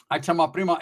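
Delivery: ordinary delivery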